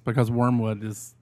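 ordinary talk

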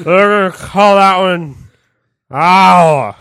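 loudly naming it; loud proclamation. Pretend. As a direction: laugh and proclaim it. Franklin naming the state.

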